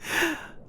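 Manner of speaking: high, breathy embarrassed laugh; awkward laugh. Real, not acted. Boyfriend realizing he doesn't know her name, awkward laugh. Realizes they never swapped names.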